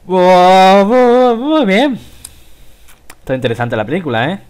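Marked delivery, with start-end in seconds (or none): 0.0-2.0 loud, high whoops of delight; excited cheer. Real, not acted. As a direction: burst out cheering. Cheering after the film ends. (exclamación eufórica)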